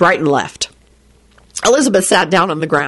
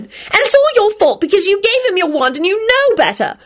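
high accusing rant; angry blaming; 11 semitones higher than usual. A narrator's reading. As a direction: pile the blame on him. Rosie berating boyfriend on phone.

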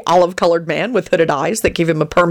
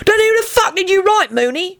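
exasperated. A narrator's reading. Exasperated demand.

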